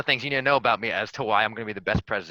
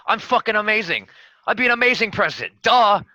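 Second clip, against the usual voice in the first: cocky, building to a loud brag; boastful; 10 semitones higher, 7 dB louder than usual. Pretend. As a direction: brag it out, louder and louder. Boastful campaign speech, building up.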